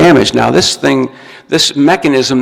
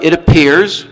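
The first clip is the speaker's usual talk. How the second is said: "appears" pitched up and pushed; stress on appears; 4 semitones higher, slower than usual. Shown as a demonstration. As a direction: hit "appears" as if doubting it. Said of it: "emphasis on the word appears".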